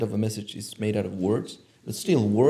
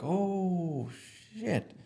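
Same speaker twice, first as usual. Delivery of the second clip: higher, soft drawn-out "oh"; hushed realization; 7 semitones higher, 10 dB quieter than usual. Retold or imitated. Recalling a personal revelation to the host, in hushed amazement. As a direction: let it dawn on you softly. Retelling his moment of realization.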